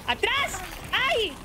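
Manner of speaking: high yelp under attack; alarma. Real, not acted. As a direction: cry out as you're being grabbed. Enemigo la agarra en el juego. (grito agudo de susto)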